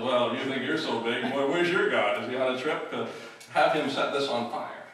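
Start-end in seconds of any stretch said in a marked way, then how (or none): 0.0-2.9 mocking taunt. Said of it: "And Elijah says".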